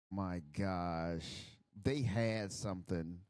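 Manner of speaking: normal talking voice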